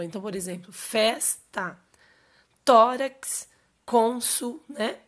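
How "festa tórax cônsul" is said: "festa, tórax, cônsul" with the first syllable punched in each; "festa, tórax, cônsul" spaced out, first syllables strong and drawn out; stress on penultimate syllable. Shown as a demonstration. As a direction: hit FES, TÓ and CÔN in "festa, tórax, cônsul", pausing between words. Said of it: "na penúltima sílaba".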